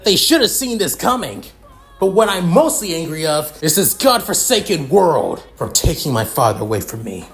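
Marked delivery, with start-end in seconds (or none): 0.0-5.7 loud, high, escalating tirade; worked-up grief-fuelled fury, hammering the curses; furious rant. Acted. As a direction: rage through the list, pitch climbing as it builds. Character rages over father's killing.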